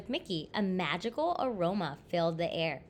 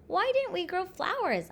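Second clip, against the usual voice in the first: put-on high-pitched child's protest; mildly plaintive storybook child; complaining question; 9 semitones higher than usual. A narrator's reading. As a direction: ask mother again, pitched up in complaint. Child repeats complaint to mother.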